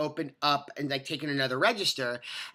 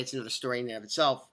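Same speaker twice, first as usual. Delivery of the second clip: ordinary talk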